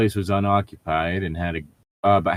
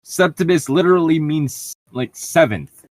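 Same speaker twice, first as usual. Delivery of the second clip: higher and louder, insistent; emphatic; 9 semitones higher, 5 dB louder than usual. Real, not acted. Co-host insisting the name means seventh, emphatic. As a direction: hammer home the obvious.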